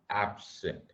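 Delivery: a timid half-heard "Absent"; mumbled answer. Shown as a demonstration. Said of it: "क्या मैंने बोला?".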